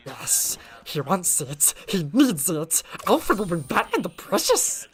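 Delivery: a creature impression rising and slowing to the last word; Gollum. Streamer showing how the impression clicked, creature-like Gollum voice. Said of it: "I heard an impression of Gollum".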